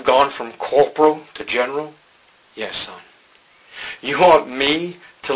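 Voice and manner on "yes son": gentle fatherly yes. Acted. God voiced calmly.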